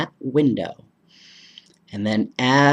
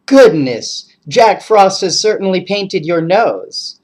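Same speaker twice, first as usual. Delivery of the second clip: amused mother; 5 semitones higher, 8 dB louder than usual. Acted. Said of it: "and then she laughed".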